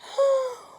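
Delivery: loud high gasp; big high gasp. Acted. Tacky gasps loudly before greeting the hunters.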